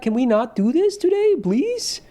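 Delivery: cracked, squeaky plea; voice cracking. Real, not acted. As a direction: voice cracking up high. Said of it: "voice crack".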